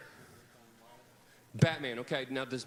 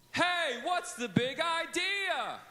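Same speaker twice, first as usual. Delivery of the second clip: high, slow sing-song shout; hollered, half-sung cue; 11 semitones higher, much slower than usual. Shown as a demonstration. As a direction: call the cue out like a game show. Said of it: "if I holler out".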